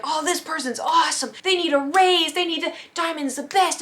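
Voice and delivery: high, hammed-up gushing; over-the-top retold adoration; gushing praise. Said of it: "praising them".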